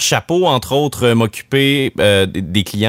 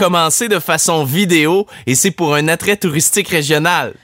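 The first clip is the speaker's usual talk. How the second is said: hype announcer; 8 semitones higher than usual. Pretend. Radio host announcing a superhero's return to listeners, hyped announcer. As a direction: announce it grandly, pitched well above normal talk.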